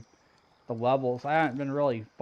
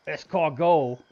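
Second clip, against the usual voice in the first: exaggerated French accent, louder; 6 dB louder than usual. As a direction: say Escargot the French way, ess-car-GO. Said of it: "Never do a French accent again".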